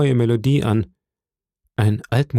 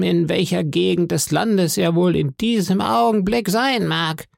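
eerie sing-song beggar; 8 semitones higher than usual. A narrator's reading. Blind beggar begging the innkeeper's boy, high sing-song voice. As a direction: lilt each phrase upward like a pitiful plea. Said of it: "sagte in einem eigentümlich singenden Ton".

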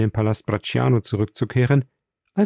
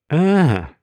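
higher exclaimed aha; a mild exclamation, pitched up; called out; 5 semitones higher, 4 dB louder than usual. A narrator's reading. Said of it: "rief der Graf". (ausgerufen, leicht gehoben)

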